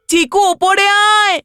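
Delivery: slow, stretched-out call to someone downstairs. Acted. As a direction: call a sibling up, dragging the words. Calling the sibling to come upstairs.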